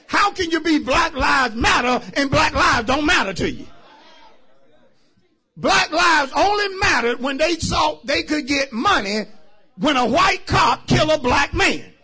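loud, indignant sermon challenge; forceful preaching. Real, not acted. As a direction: hammer the argument out to the congregation.

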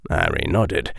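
plain reading voice

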